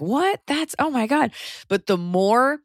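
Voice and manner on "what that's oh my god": shocked. Retold or imitated. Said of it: "You're just like".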